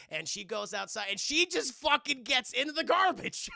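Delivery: normal talking voice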